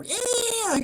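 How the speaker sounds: a very high squeal imitating tires; mock tire screech.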